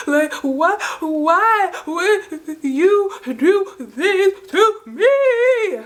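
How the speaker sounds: exaggerated high, slow sobbing imitation; mock her wailing between gasps, drawn out and high; hyperventilating boo-hoo crying. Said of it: "hyperventilating, boo-hoo crying".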